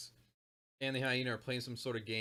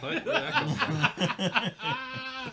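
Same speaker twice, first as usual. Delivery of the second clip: surprised laughing; 11 semitones higher, 5 dB louder, much slower than usual. Real, not acted. Startled outburst, laughing.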